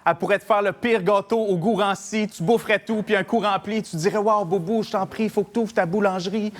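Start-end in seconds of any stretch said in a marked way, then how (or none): none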